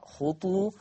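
خطو with a rounded u on the ta; damma on the ta. Shown as a demonstration. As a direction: say خطو as khutu, with u after the t. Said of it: "يقرأ بضم الطاء". (خطو بضم الطاء: خُطُو)

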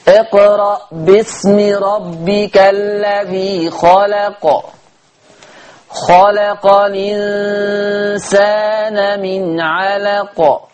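slow melodic tajweed chant; Quran recitation. Shown as a demonstration. Teacher recites al-Alaq to show the rule. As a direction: stretch each word in recitation.

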